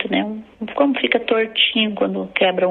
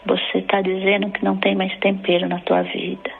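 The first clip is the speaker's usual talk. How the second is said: ordinary talk, nothing marked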